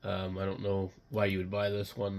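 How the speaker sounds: ordinary talk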